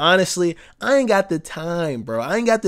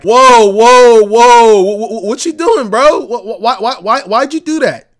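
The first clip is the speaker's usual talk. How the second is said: loud, high, panicked insurance company voice; a hammed-up insurer yelling at a customer who crashed; mock alarmed insurer; 5 semitones higher, 10 dB louder than usual. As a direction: play the outraged insurer, shouting and stammering. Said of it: "They're like".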